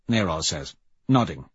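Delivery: ordinary delivery, nothing marked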